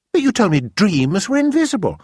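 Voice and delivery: put-on a puzzled girl objecting; Sophie puzzled. A narrator's reading. Sophie to the BFG, confused about invisible dreams.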